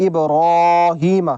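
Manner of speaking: ابراہیم very slowly recited with a full ra; ابراہیم drawn out with heavy ra. Shown as a demonstration. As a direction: chant ابراہیم with a thick ra. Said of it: "را کو پر پڑھا جاتا ہے".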